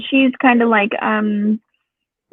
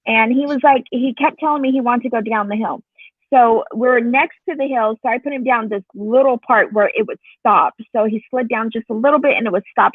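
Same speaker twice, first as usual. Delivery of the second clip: normal talking voice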